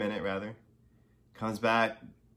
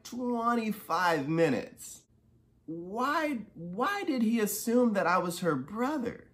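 incredulous outrage; 8 semitones higher, slower than usual. Real, not acted. Dad telling viewers why the clinic's mistake enraged him, incredulous and high. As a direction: pitch shooting much higher in disbelief, stretched words. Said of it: "where the rage comes from".

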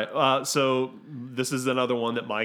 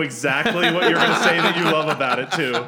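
loud, raised voice through laughter; laughing; 6 semitones higher, 9 dB louder than usual. Real, not acted. Cutting in to tease a co-host's favorite part, laughing. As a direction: tease them, laughing and talking loudly. Teasing a co-host while laughing.

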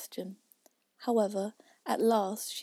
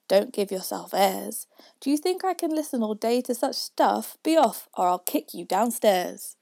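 angry father; 9 dB louder than usual. A narrator's reading. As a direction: scold him off.

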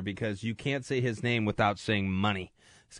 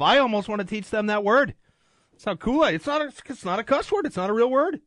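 higher, rising, half-joking excitement; playful excitement; 10 semitones higher, 4 dB louder than usual. Real, not acted. Host joking about teaching his kids the word, higher and rising. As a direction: talk it up like a giddy confession.

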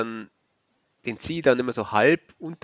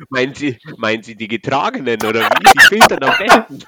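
mock incredulous question; 11 dB louder than usual. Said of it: "Ich sag".

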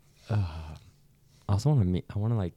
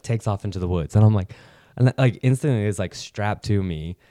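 ordinary talk

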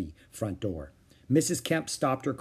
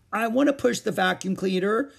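much higher, louder demand; whiny child insisting; screamed; 9 semitones higher, 4 dB louder than usual. A narrator's reading. Susan demanding the vacuum, screaming. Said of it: "screamed Susan".

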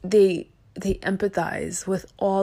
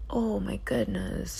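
soft breathy whisper of disbelief; 2 semitones higher, 7 dB quieter, slower than usual. Real, not acted. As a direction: whisper it to yourself in mock shock. Repeats the exclamation, whispered.

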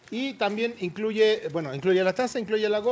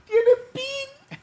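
mock echo; much slower than usual. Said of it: "¿Tienen qué?".

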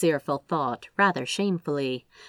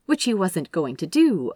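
plain reading voice

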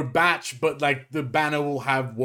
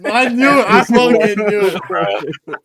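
loud, higher, drawn-out gotcha; triumphant shout; 7 semitones higher, 9 dB louder, slower than usual. Real, not acted. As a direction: crow it loudly, you caught him. Catching a friend out.

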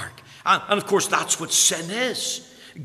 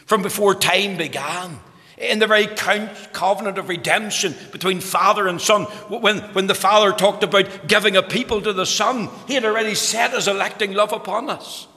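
higher and louder, driving the phrases; full-force preaching; 6 semitones higher, 5 dB louder than usual. Acted. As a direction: proclaim eternity with fervour.

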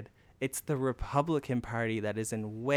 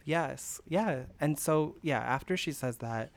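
ordinary delivery

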